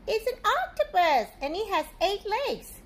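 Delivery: high, loud storybook wonder; excited. Acted. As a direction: present the octopus like a thrilling find. Dramatic octopus reveal.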